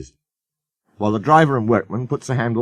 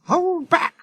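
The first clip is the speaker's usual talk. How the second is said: plain reading voice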